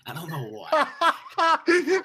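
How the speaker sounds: squeaky laugh through the words. Real, not acted. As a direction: break into a high giggle as you finish. Laughs at own odd idea.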